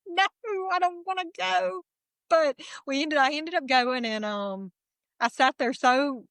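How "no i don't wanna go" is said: high, whining no through laughter; whiny refusal. Retold or imitated. To her husband after pickleball, a high laughing whine. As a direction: play the sulky kid who won't go.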